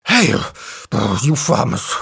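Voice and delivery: panting elephant voice; out of breath. A narrator's reading. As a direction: gasp it between breaths. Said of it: "he panted".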